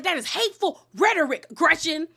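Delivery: lightly voiced indignant rebuke; upset. Heather scolding Gretchen, mock indignant. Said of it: "Oh, she was upset".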